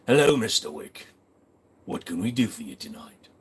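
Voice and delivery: badly faked posh British accent; attempted posh British. Streamer greets Mister Wick as sommelier, attempted posh British accent. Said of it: "I can't do a posh British accent".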